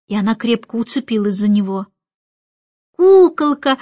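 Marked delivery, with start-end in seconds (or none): 3.0-3.8 put-on louder, higher childlike exclamation; admiring delight. A narrator's reading. Little boy admiring the baby, admiring delight. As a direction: coo out loud with admiration. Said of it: "восхищался Джон".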